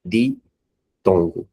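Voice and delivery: ditongo spoken very slowly as di-ton-go, each syllable separate; split into syllables. Shown as a demonstration. Teacher spelling out a word's syllables for the class, slow and separated. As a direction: break ditongo into its three syllables for pupils. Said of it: "chamado de".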